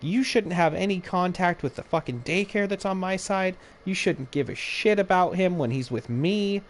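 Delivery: high, snippy mimic of the ex-wife; mocking the ex. Venting friend imitating his ex-wife, high and snippy. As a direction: mimic her whiny defensive tone. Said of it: "she's like".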